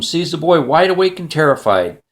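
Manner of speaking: ordinary delivery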